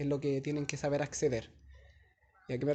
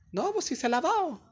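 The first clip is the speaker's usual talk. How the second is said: 1 imitated insistence; 12 semitones higher, 3 dB louder than usual. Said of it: "cuando uno dice".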